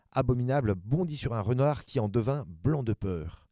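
usual speaking voice

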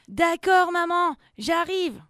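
put-on high, loud child calling back; little boy's voice. A narrator's reading. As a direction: answer mum from the bath as a small boy. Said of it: "Une voix de petit garçon répond". (voix aiguë de petit garçon)

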